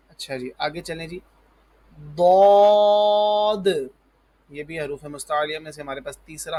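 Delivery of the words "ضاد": ضاد thick, high and very loud, ending in an audible d; heavy daad. Shown as a demonstration. Teacher models for pupils, heavy long ضاد. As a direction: puff the mouth and sustain ضاد loudly. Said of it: "موٹا پڑھا جائے گا اور د آخر میں آواز".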